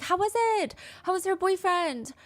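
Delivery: high, lilting curious mother; eager mom. Retold or imitated. Mom asking daughter about the boyfriend, eagerly curious. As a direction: mimic a mom keen to hear all about it.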